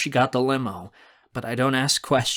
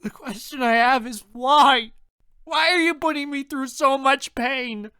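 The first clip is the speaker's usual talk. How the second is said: high, anguished demand; loud sobbing; 14 semitones higher, 5 dB louder than usual. A narrator's reading. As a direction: demand an answer while crying hard. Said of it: "in front of me and sob loudly".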